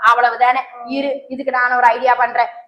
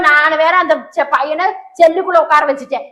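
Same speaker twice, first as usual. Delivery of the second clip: normal talking voice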